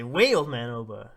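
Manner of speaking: loud acted shout to halt; urgent call. Villagers stop old man Oba, loud urgent call. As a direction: call out sharply to stop someone.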